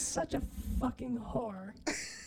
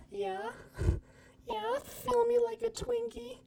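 higher, hammed-up sexy woman voice; mock sexy voice; 10 semitones higher than usual. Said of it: "I'll do a reenactment".

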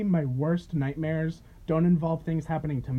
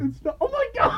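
laughing outburst. Real, not acted.

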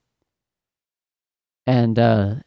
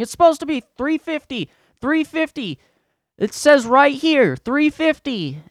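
high-pitched mock shouting, slowing for emphasis; yelling; 13 semitones higher, 5 dB louder than usual. Upset guest disputing the water price, mock yelling. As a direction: imitate an outraged customer hammering the price. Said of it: "He's just fucking yelling, yelling, yelling".

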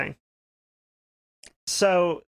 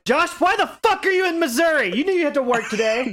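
laughing mock outrage; 9 semitones higher, 3 dB louder than usual. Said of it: "So I was like".

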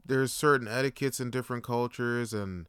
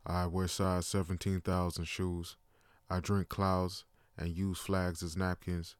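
Shanae O'Neal voice; 9 semitones lower, 8 dB quieter than usual. Retold or imitated. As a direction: drop into a deep, lumbering giant voice. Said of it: "My name's Shanae O'Neal".

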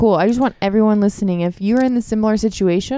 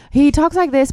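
high-pitched imitation of a reality-show character; Joe Exotic; 6 semitones higher than usual. Shown as a demonstration. Cohost showing listeners how the tiger man talks, high-pitched impression. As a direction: pitch it up to mimic him. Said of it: "So he talks like this".

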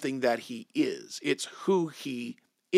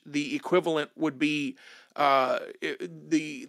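normal talking voice

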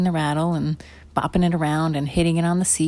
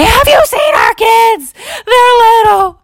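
high, loud, slow tearful cry; desperate parents shouting through tears; frantic crying shout; 10 dB louder, slower than usual. Acted. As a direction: beg for news of the lost kids. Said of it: "frantic, scared-looking people".

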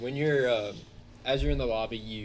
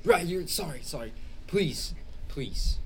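pleading; 6 semitones higher, 6 dB louder than usual. Acted. Sobbing father begs again.

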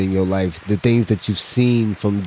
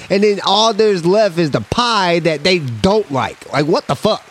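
high exasperated rant; 10 semitones higher than usual. Real, not acted. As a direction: voice pitched way up, complaining. Ranting about pies.

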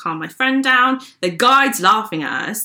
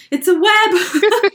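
higher, slow, drawn-out panicked repeat; panicked squeal; 12 semitones higher, 4 dB louder, much slower than usual. Retold or imitated. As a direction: stretch the words out in alarm. Panic on seeing the web.

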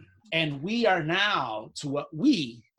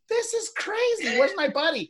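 high disbelief; 12 semitones higher than usual. Retold or imitated. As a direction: squeak it out in disbelief. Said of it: "I'm like".

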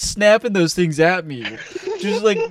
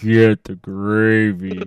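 low, slow, put-on creepy voice; creepy cashier; 8 semitones lower, much slower than usual. Imitating the Dairy Queen cashier urging gravy, low slow croon.

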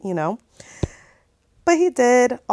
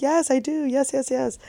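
high-pitched, tender retold yes; emotional acceptance; 6 semitones higher, 3 dB quieter than usual. Girlfriend accepting the proposal, high emotional yes. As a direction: re-enact saying yes, high and eager.

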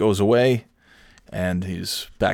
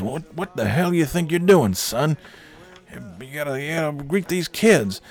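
put-on high-pitched bossy old boss barking orders; Cookie voice; 8 semitones higher than usual. Boss scolding Lenny in the Santa suit, high bossy voice. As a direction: play the pushy boss, pitched way up. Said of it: "Cookie comes in and says".

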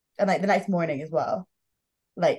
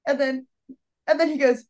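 ordinary talk

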